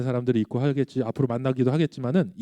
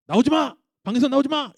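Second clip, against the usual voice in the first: high-pitched scolding shout, acted; angry order; 11 semitones higher than usual. Dad to son shut in room, high angry order. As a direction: order a child to stay put, voice pitched way up. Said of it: "감정이 사그라들었음에도 불구하고".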